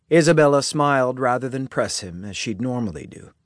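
plain reading voice

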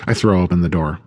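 usual speaking voice

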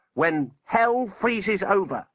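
slow, high-pitched defiance; defiant wizard. Acted. Wizard defies Death.

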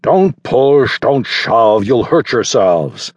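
loud warning shout over the crowd; caretaker shouting. A narrator's reading. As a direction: call out to push back the jostling kids.

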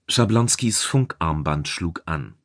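ordinary delivery

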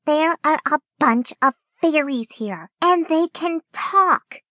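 happy excitement. A narrator's reading. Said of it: "look this happy before".

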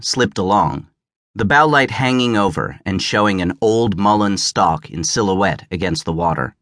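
ordinary delivery, nothing marked

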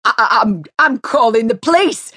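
high, loud, stammering panic; spluttering. A narrator's reading. Neighbour spluttering a threat, panicked. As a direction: stumble over the words. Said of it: "he spluttered quickly".

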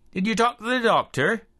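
concerned British secretary asking; British accent. A narrator's reading. Rosemary voiced on phone.

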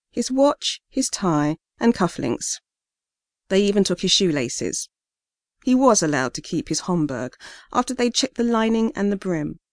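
ordinary delivery, nothing marked

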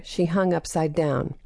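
usual speaking voice

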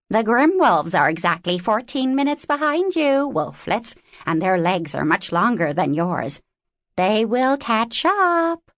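put-on a raven's high, strange voice, warning the young wolves; a cocky little raven delivering bad news, pitched high. Raven Tlittu speaks.

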